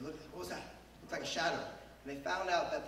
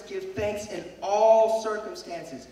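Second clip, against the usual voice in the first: "Give thanks in all circumstances" slow and louder, stress on "all"; emphatic scripture quote; 3 semitones higher, 8 dB louder, much slower than usual. Shown as a demonstration. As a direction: lean hard on "all", slowly. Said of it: "this verse says".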